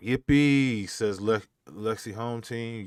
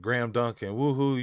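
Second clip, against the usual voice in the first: normal talking voice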